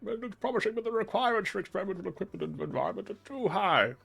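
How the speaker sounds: professor voice. Acted. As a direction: sigh over the funding as the professor. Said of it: "I'm guessing this is the professor".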